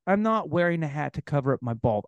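ordinary talk, nothing marked

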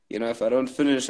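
normal talking voice

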